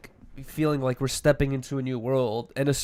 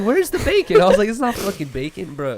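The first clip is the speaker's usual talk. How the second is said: loud, much higher cry of disbelief; outraged question; 5 semitones higher, 6 dB louder than usual. Retold or imitated. Complaining about the airline breakfast, a loud high outraged cry. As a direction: demand the missing bacon loudly, high and indignant.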